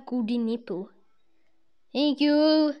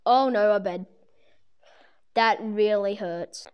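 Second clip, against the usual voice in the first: ordinary talk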